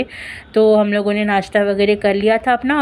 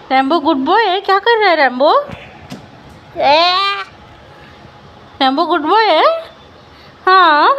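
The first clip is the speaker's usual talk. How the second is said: squeaky praising a dog; 9 semitones higher, 4 dB louder than usual. Real, not acted.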